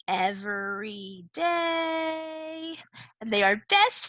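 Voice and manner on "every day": "Every day" stretched very slow, lifted high; drawn-out emphasis. Shown as a demonstration. Teacher stressing a poem's key phrase: very slow "Every day". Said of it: "in every day".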